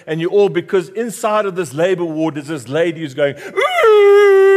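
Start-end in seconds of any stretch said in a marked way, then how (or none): 3.6-4.5 high, loud, drawn-out cry of a woman in labour. Retold or imitated. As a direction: let out a long high birthing wail.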